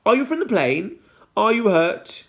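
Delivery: lightly voiced worried girl; urgent child. A narrator's reading. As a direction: call out to check on him. Children call to Fred.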